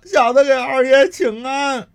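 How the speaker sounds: bow and scrape in a lackey voice; groveling servant. Acted. Thug greets the master.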